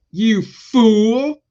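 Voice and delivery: high loud jeering you fool; loud high taunt; mock shout. Real, not acted. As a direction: yell it up high. Mocking the fooled barbarians.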